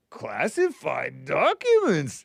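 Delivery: slow, strangled mocking voice with leaping pitch; mock it like you're dim; accidental Yoda-like mockery. Shown as a demonstration. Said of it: "I was mocking it".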